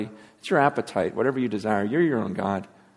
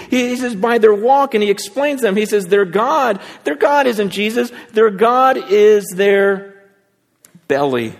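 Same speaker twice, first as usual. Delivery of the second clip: raised pitch, urgent pulpit delivery; forceful preaching; 8 semitones higher, 7 dB louder than usual. Real, not acted. Preacher confronts the congregation about their walk, urgent preaching. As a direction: press the warning home insistently.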